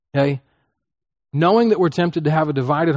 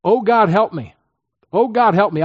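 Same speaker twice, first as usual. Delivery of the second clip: raised, pleading mock prayer, higher and louder; mock general prayer; 6 semitones higher, 5 dB louder than usual. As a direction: ham up a vague cry to God. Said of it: "I can kid myself".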